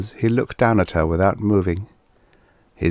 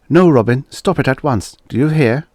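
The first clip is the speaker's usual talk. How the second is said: firm, raised motherly rebuke; sharp maternal scolding; 7 semitones higher, 5 dB louder than usual. A narrator's reading. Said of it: "She took refuge in the sharp maternal note".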